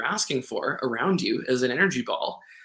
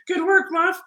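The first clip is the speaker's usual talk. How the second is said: much higher, louder praise for the dog; pet praise voice; 4 dB louder than usual. Real, not acted. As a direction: squeal praise at the puppy.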